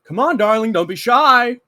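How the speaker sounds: high, loud beckoning call across the camp; coaxing call. Acted. Camp greeter calling out to a shy newcomer, high and loud. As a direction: wave them over, come on darling. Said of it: "and he's just like".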